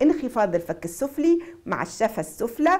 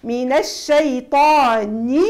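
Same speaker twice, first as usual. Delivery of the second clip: slow louder min ash-shayṭāni; tajweed model reading; slow recitation; 5 dB louder, much slower than usual. Shown as a demonstration. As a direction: recite it stretched and clear. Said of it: "teacher demonstrating recitation".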